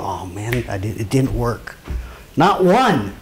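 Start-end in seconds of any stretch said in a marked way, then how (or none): 0.0-1.8 low, quiet mock letdown; mock disappointment. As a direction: act out someone walking away let down. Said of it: "No one walked away going".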